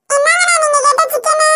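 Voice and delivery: put-on high little girl offering to carry the plate; child's voice. Young daughter offers to carry the customer's plate in a childlike voice. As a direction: pitch up like an eager little kid.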